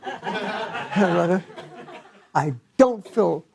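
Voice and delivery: laughing. Real, not acted. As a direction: giggle through the confession about the card. Pastor laughing at own story.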